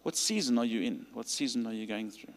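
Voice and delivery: usual speaking voice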